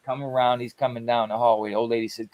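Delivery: ordinary talk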